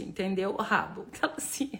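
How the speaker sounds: ordinary talk, nothing marked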